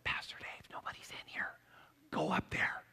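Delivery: hushed whisper, barely voiced; whispering tour group. Tour group urging the pastor, in a whisper. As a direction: whisper it so nobody hears. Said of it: "So they said".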